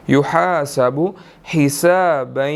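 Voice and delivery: slow "युहासबु हिसाबन" with a nasal hum; "युहासबु हिसाबन" nasalised, slow; ghunna on the doubled letter. Shown as a demonstration. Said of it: "मुशद्दिद है, यहाँ पर हमने गुन्ना करना है".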